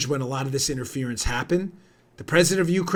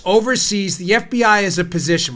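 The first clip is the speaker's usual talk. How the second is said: ordinary talk, nothing marked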